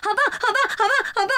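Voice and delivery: high, laughing imitation of the cheer; loud clear sleep-talk. 学室友梦里大声清楚地喊好棒. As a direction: shout it clearly like a dreaming friend. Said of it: "做梦这么大声，然后这么清楚".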